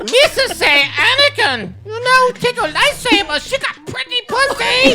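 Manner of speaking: Jar Jar Binks. Retold or imitated. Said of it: "do it one more time as Jar Jar".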